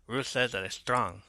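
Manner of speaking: ordinary talk, nothing marked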